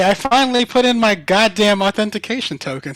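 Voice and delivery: loud, high triumphant outburst; excited shout. Real, not acted. Announcing to the group that the noises work, excited shout. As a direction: burst in shouting over everyone. Bursts out over working stream noises.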